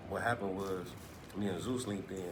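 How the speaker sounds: normal talking voice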